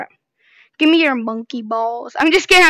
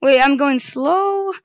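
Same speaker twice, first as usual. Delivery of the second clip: ordinary talk, nothing marked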